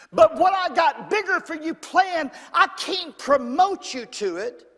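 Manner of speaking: high, loud preacher's push; forceful preaching. Acted. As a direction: drive it home to the congregation.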